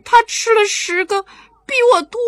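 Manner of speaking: put-on high, loud little girl voice; tattling child. Maomao telling mother it was Songsong's idea, high tattling child. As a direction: blame him like a kid.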